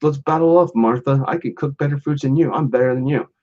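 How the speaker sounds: mild mock trash talk; cocky Jack. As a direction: brag like a fast-food mascot picking a fight. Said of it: "Jackie keeps saying".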